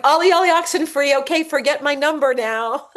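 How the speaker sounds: put-on high, loud childish game call; host joking about calling off God; playful sing-song call. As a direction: chant it like a kids' hide-and-seek call.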